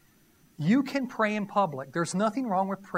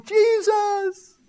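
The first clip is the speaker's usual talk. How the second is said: high, loud panicked yell of Jesus; panicked cry; 11 semitones higher, 9 dB louder than usual. Retold or imitated. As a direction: scream it as the bombs go off. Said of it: "they're going".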